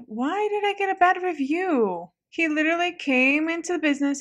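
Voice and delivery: indignant wail. Real, not acted. Shop owner gets a bad review, indignant wail. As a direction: wail about how unfair it is.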